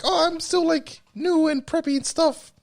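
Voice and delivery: high-pitched eager newbie voice; young rookie elf. Acted. Explaining the elf duo, acting the high-voiced young rookie. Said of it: "the young, like".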